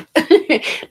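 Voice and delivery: high, loud burst of laughter; real laugh. Real, not acted. Laughs at own joke.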